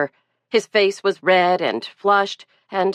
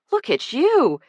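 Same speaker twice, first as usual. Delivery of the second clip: higher, surprised mother's exclamation; exclaiming; 9 semitones higher than usual. A narrator's reading. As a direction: exclaim it at the snowy son. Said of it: "exclaimed Lucy".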